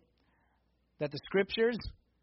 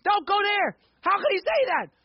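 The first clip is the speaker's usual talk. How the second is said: loud, high, urgent calls at the story; excited yelling; 10 semitones higher, 6 dB louder than usual. Pretend. Preacher playing a fan yelling at the Bible. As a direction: shout warnings into the scene. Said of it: "be like".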